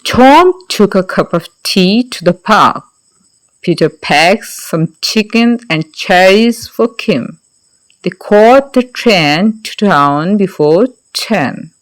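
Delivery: slow, deliberate model reading; slow read-aloud. Shown as a demonstration. Teacher models the passage for students, slow and clear. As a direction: read each word out slowly and clearly. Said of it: "hope so you have read it".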